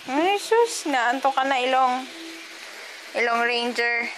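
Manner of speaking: put-on high, loud sing-song baby talk to a toddler. Mom coaxing her sleepy toddler to bed, in high baby talk. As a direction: coo at the little one like a doting mom.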